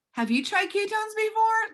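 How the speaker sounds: high, sing-song question to a stranger; chirpy sales pitch. Acted. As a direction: pipe up to hook the postal clerk. Acts out pitching postal worker.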